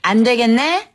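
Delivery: patient, firm teacher discipline. Acted. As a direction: lay down a time-out for a child. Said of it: "오은영 박사님 같은 단호함".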